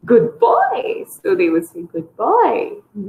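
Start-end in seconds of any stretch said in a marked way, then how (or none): 0.1-1.1 high-pitched, sing-song praise; praise intonation. Shown as a demonstration. Therapist praising a child, high sing-song intonation. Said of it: "same intonation you're telling". 1.8-2.7 put-on child echoing sing-song praise; copied praise intonation. Children copying their parent's praise, imitated sing-song. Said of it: "say it in the same intonation".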